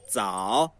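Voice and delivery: zao dito baixo, sem sopro de ar; Mandarin zao. Shown as a demonstration. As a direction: pronuncie zao não aspirado, com a voz grave. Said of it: "por exemplo a palavra". (zao sem aspiração, tom baixo)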